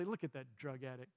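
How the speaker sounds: muttered low and soft, not out loud; a quiet scornful aside, kept to himself; under the breath. Retold or imitated. Believer judging a broken person, muttered under the breath. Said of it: "I don't say it out loud".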